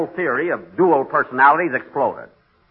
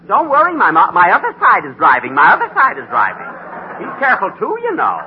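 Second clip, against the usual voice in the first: raised, emphatic sarcasm; sarcastic; 7 semitones higher, 5 dB louder than usual. Acted. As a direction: answer back with pointed sarcasm. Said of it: "don't be so sarcastic".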